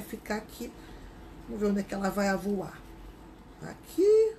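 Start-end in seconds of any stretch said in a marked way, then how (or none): 3.6-4.3 excited exclamation. Real, not acted. Excited finding spot.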